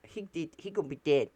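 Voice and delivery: lightly put-on quick voice; imitating her. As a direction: quote her fast in a put-on voice. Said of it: "she went".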